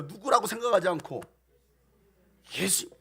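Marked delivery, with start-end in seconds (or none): none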